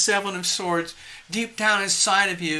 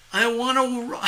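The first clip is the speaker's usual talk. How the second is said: laughing; 6 semitones higher than usual. Real, not acted. Laughs reading viewer's words.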